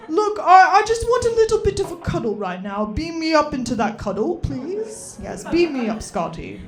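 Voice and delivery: put-on British accent, pleading for a cuddle; a hint of British, coaxing and sweet; Patrick Stewart British voice. Cat begging owner for cuddles in Patrick Stewart's British accent. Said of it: "British voice of Patrick Stewart".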